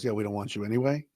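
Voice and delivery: mock brush-off. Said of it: "and then he goes".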